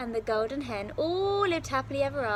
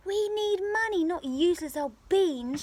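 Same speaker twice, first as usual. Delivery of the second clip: high, loud exasperated scolding; angry mother; 7 semitones higher, 5 dB louder than usual. Acted. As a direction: cry it out at her foolish son. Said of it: "she cried".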